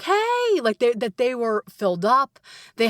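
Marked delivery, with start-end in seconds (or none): 0.0-0.6 cheerful hey. Retold or imitated. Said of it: "that they're like".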